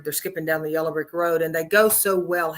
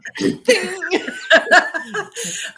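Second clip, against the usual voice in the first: high, loud self-conscious laugh; burst of laughter; 13 semitones higher, 6 dB louder than usual. Real, not acted. Guest laughing at her own procrastination, loud high laugh.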